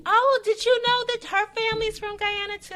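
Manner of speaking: very high, loud delighted chirping; mock gushing. Imitating coworkers who brought nannies in, mock gushing. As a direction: overdo the delighted introduction. Mimicking employer introducing a nanny.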